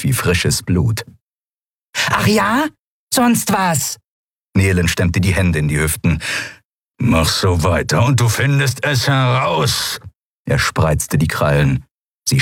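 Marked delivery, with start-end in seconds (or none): 2.1-4.0 high-pitched challenge; defiant Nelen. Acted. Nelen challenging Keru's threat, high defiance. 7.0-10.0 slow, drawn-out threat; threatening Keru. Acted. Keru threatening defiant Nelen, slow menace.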